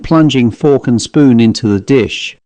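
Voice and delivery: usual speaking voice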